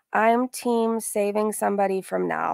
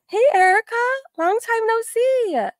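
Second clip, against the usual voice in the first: delighted greeting; 5 dB louder than usual. Real, not acted. Greeting Erica after a long time, high and delighted. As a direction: greet them brightly, voice way up high. Greets a viewer mid-stream.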